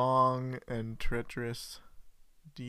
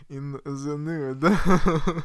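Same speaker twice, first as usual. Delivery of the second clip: laughing; 4 semitones higher, 9 dB louder, much slower than usual. Real, not acted. Joking about nude paintings.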